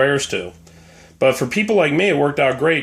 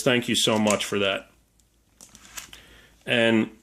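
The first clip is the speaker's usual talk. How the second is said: usual speaking voice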